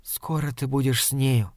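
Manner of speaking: softened, confiding near-whisper; lightly hushed, urgent aside; hurried whisper. A narrator's reading. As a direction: murmur it softly, as a secret. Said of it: "торопливо шепнула она".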